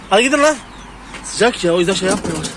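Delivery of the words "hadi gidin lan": high, louder shooing command; chasing them off. Real, not acted. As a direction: drive the birds away sharply. Chasing animals off. (hayvanları yüksek sesle kovalayarak)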